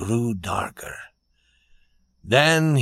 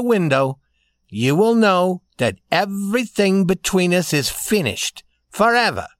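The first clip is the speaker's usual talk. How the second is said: higher, emphatic lady's ultimatum; young lady, firm; 7 semitones higher, 3 dB louder than usual. A narrator's reading. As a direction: lay down her terms with finality.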